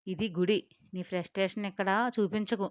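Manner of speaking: ordinary delivery, nothing marked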